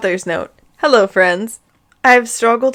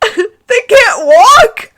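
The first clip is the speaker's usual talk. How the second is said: much higher, louder, slow, through laughter; laughing outburst; 13 semitones higher, 9 dB louder, much slower than usual. Real, not acted. Reader squealing through laughter at the absurd prayer. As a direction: squeal it while cracking up. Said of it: "laughing outburst".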